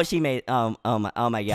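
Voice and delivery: very low, hushed disbelief; stunned dismay. Real, not acted. Player watches the rival score late, hushed low dismay. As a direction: let the voice drop to a deflated murmur. Opponent scores near the end.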